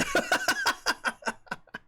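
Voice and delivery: breathy high laugh; airy laugh. Real, not acted. Streamer laughing at chat's man-cold joke, breathy laugh.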